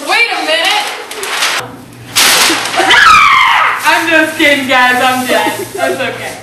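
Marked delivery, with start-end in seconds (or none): 0.9-3.8 high, shouted maniacal cackle; maniacal laugh. Acted. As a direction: burst into a crazed laugh. Guilty burst of laughter.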